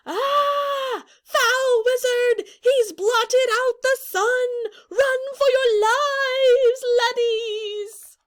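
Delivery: a frantic soldier yelling to flee; mock panic. Panicked character screams.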